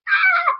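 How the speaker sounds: short high shriek of joy; mock scream of excitement; inner scream. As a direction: shriek like you're freaking out inside. Freaking out in my head.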